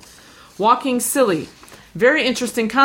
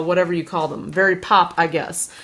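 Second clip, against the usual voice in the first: normal talking voice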